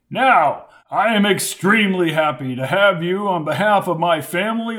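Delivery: grand formal speech voice; pompous senator oratory. A narrator's reading. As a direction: orate like a proud senator.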